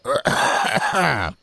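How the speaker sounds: put-on old man clearing his throat. A narrator's reading. Old man about to address the spirit, clearing his throat. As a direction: clear the throat before speaking. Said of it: "সামান্য গলা খাঁকারি দিয়ে".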